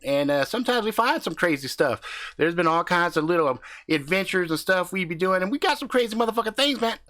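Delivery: brash country husband hyping up his channel; cocky backwoods YouTuber swaggering through the intro; crude hillbilly husband. Acted.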